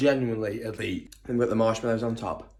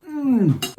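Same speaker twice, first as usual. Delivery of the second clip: high pained hum; 7 dB louder than usual. Real, not acted. Burnt mouth, tasting.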